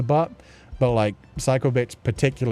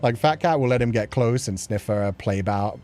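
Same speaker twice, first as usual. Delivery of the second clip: ordinary talk, nothing marked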